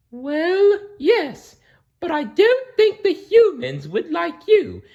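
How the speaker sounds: high, loud cautious cow. Acted. The cow warns Blinky about the humans, high and loud. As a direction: warn him gently in a high cow voice. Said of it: "said the cow".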